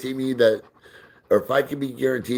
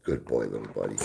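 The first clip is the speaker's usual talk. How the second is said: quiet, lower, cooing praise for the dog; soft dog praise; 12 semitones lower, 7 dB quieter, faster than usual. Real, not acted. Praising his dog on camera.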